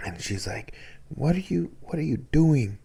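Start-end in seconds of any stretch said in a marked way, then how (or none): 1.1-2.8 much higher, fast scolding mother voice; exasperated grandmother scolding her son. Retold or imitated. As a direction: tell him off like an exasperated abuela. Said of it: "She's starting to scold him".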